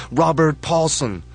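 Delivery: high, loud, slow chant of the name; crowd yell. Acted. Crowds chant the dead man's name, loud. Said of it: "the crowds yell".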